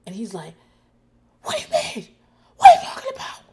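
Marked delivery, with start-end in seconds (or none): 1.4-3.4 very high, loud screeching imitation; mock screaming. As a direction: shriek it, mimicking his outraged yelling. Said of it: "He's screaming it".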